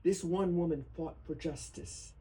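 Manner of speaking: earnest, rising poetry recital; solemn reading. Acted. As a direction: read it as a tribute that builds. Reads protest poem aloud.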